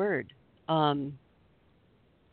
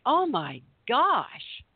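drawn-out, stunned oh my gosh; slow disbelief; 6 semitones higher, much slower than usual. Retold or imitated. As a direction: stretch it out in retold disbelief. Reacting to the rude driver.

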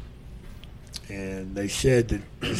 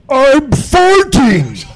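much higher, loud, slow hammed-up giant voice; giggling giant; 11 dB louder, much slower than usual. Andre confessing to the referee mid-match, slow high loud giant voice. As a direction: stretch it out like a giddy giant confessing. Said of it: "Andre goes".

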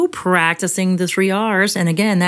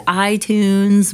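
ordinary talk, nothing marked

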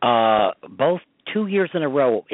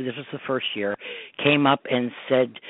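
ordinary delivery

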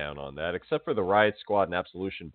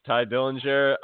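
ordinary talk, nothing marked

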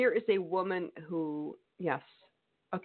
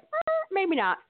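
skeptical; 14 semitones higher, 4 dB louder than usual. Retold or imitated. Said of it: "are gonna be like".

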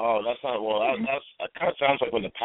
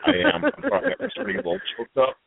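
much higher, hammed-up teary voice; mock crying; 10 semitones higher, faster than usual. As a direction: fake a choked-up sob, high. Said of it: "So now you can cry again".

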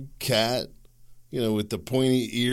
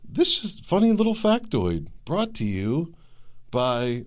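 high, put-on radio announcer read; mock NPR announcer; 7 semitones higher, 3 dB louder than usual. Podcaster mocking an NPR opening, hammed-up announcer. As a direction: play a sterile public radio host. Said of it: "how would N P R have opened".

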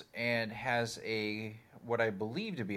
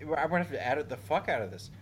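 ordinary talk

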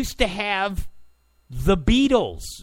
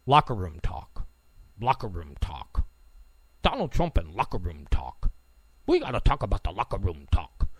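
very low, creaky mock-ominous voice; mock media refrain; 12 semitones lower, 4 dB quieter, faster than usual. Host parodying the media obsessed with locker room talk, mock-ominous. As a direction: drop way down, half-whispering by the end.

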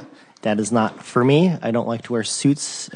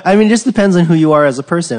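loud, fast, laughing through it; 7 semitones higher, 8 dB louder, much faster than usual. Real, not acted. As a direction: blurt it out mid-laugh. Laughing burst after joke.

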